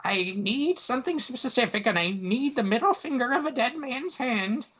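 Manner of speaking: put-on high-pitched witchy old woman; name the spell ingredient in a high old-lady voice; old witch lady. Witch tells burglar what she needs, high old-lady voice. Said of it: "She's like".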